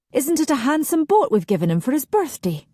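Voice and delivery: proud mother. A narrator's reading. Mother proudly showing off Gavin's boat to guests. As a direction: boast about the gift like a doting mother. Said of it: "Mother saying proudly".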